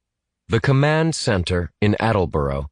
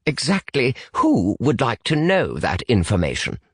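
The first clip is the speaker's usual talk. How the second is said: higher, very stiff British English; stiff British accent; 5 semitones higher than usual. Acted. British boy challenges an unknown caller, stiff British accent. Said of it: "in his stiffest British accent".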